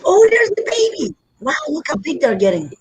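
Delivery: high, fast cooing at the puppy. Real, not acted. Owner spotting the puppy, high baby-talk coo. Greeting the puppies.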